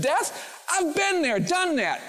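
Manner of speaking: Jesus brushing off death, high and breezy; casual Jesus. Acted. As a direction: toss it off like death was nothing. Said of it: "Jesus says".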